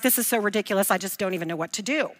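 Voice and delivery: mock exasperation. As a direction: rattle it off quietly, as if giving up. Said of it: "Now he's just like".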